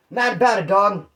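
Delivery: ordinary talk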